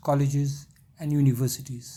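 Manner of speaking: ordinary delivery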